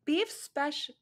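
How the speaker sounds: put-on mild girly Ino order.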